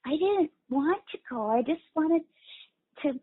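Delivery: put-on high, pulled-in little girl voice; little girl talking on an in-breath. Shown as a demonstration. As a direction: speak like a shy little girl drawing inward. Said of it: "it would sound like".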